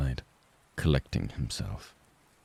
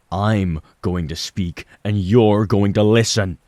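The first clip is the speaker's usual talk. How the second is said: louder, slower, each word pressed hard; firm loud retort; 5 semitones higher, 10 dB louder than usual. Acted. Son overriding his father, now loud and deliberate. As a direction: take the floor back, forceful and slow. Mike finally stands up to Dad.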